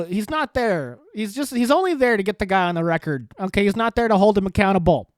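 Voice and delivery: normal talking voice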